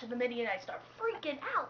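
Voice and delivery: ordinary delivery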